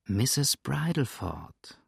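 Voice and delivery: slow, coaxing appeal; tender wheedling; soft, melting. Acted. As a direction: say the name softly and sweetly, drawn out. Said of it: "mit sanfter, schmelzender Stimme".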